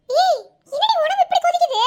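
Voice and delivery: startled cry at her hot forehead; alarmed exclaim. Acted. Aunt alarmed at fever. (காய்ச்சல் கண்டு பதறிய குரல்)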